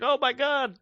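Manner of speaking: high, thrilled gasp of joy; light up like the gift made his night; delighted cabbie. Retold or imitated. Cabbie thanking them for the donuts, high and delighted.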